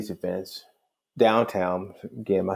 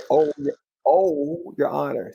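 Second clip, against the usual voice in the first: higher, drawn-out mock "oh"; retold realization; 6 semitones higher, slower than usual. Young man stepping back from the judge, startled realization. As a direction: imitate the penny slowly dropping. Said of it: "he kinda stepped back like".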